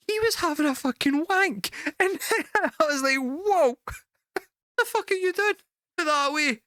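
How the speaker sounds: high-pitched, laughing through the words; can barely get it out for laughing; cracking up while telling the alley story. Real, not acted. Laughing while retelling the wank.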